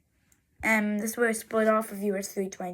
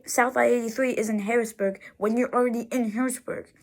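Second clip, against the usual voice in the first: ordinary talk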